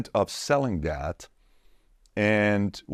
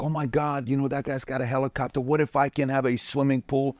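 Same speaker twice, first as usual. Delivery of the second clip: higher-pitched imitation of a starstruck kid; awestruck kid; 4 semitones higher than usual. Former kid marveling at helicopters and mansions, awestruck imitation. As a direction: mimic a kid gushing over luxury. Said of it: "as kids, we used to be like".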